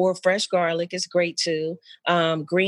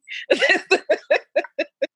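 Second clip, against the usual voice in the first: high laugh; 11 semitones higher, 3 dB louder than usual. Real, not acted. Laughing after quoting friend.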